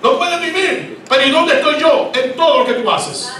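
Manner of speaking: high, loud, sarcastic rebuke; God reproaching sarcastically. Acted. The Lord scolding a believer who claims to need Him, sarcastic rebuke. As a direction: throw their words back at them. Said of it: "El Señor dice".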